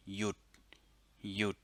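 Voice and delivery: yut said twice with a Thai low tone. Shown as a demonstration. Teacher modelling yut on a low tone. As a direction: keep yut low and level. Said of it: "sorry, low tone".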